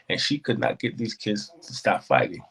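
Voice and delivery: usual speaking voice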